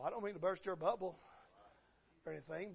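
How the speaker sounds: ordinary delivery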